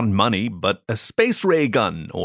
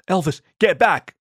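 higher, louder warning call; yelled; 8 semitones higher, 3 dB louder than usual. A narrator's reading. Narrator yells to Elvis to get back, higher warning shout. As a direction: shout a warning to your friend. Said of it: "I yelled".

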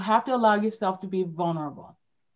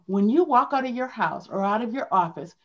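ordinary delivery

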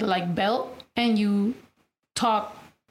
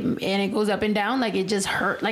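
ordinary talk, nothing marked

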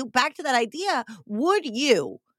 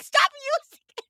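much higher, fast exasperated plea; 13 semitones higher, faster than usual. Retold or imitated. Telling the spam emailer to stop using the team name, high and fast. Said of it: "And I'm like".

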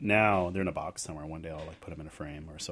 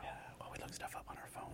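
whispering; 16 dB quieter than usual. Pretend. Keeps whispering joke.

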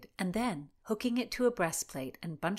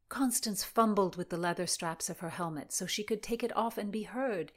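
usual speaking voice

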